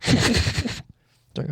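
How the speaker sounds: Kelpie impression. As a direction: squeal like a water horse. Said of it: "my Kelpie impression".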